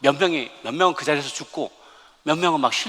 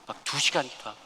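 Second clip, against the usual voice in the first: ordinary talk, nothing marked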